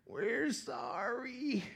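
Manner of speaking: soft, slow, mumbled sorry; sheepish apology. Retold or imitated. Israelites apologizing to God, soft sheepish mumble. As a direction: drag out a small guilty sorry. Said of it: "guess what they say".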